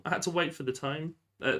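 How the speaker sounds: usual speaking voice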